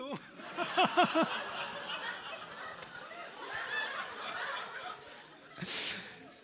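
very high breathy mock shriek of fright; high airy laugh-gasp, hammed up; squeaky startled gasp-laugh. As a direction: imitate her jumping in shock. Woman's startled reaction imitated.

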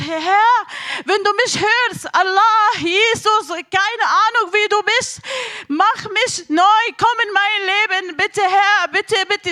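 loud, high, imploring call to God; cried-out prayer. Retold or imitated. Retelling her prayer on the mountain, crying out. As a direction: cry out a desperate plea to heaven. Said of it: "Ich habe geschrien".

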